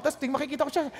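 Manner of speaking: usual speaking voice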